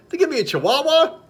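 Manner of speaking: high-pitched incredulous punchline; indignant, rising squeal of a question; mock disbelief. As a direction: act shocked at being duped. Punchline, blind man feigns shock.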